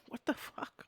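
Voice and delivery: breathy whisper of disbelief, almost no voice; disbelieving whisper. Retold or imitated. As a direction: whisper it as if you can't believe what you're seeing.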